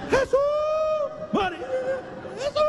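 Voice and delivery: high shrieked imitation of a frightened mother; mock scream. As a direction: squeal the names in fright. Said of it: "Y ella".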